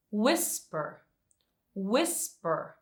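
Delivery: "whisper" with the first syllable stressed, then falling. Shown as a demonstration. Teacher showing students the stress, "whisper" stressed on its first syllable. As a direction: punch the start of "whisper", let the end drop. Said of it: "stress is in the first syllable".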